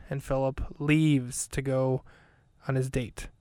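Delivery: usual speaking voice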